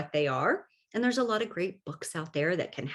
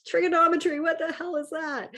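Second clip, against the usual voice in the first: high, loud mock-baffled voice; bewildered outburst, way up high; exasperated math student; 10 semitones higher, 3 dB louder than usual. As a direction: squeal out the confusion. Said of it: "where I'm just like".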